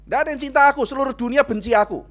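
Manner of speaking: high, loud mock whine; hammed-up teenage lament; wailing teen self-pity. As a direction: overplay a teen who feels unloved.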